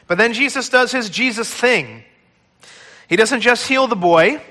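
usual speaking voice

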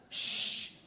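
long unvoiced shushing; impatient shush. Annoyed crowd hushing the blind man, impatient shush. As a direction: shush a nuisance.

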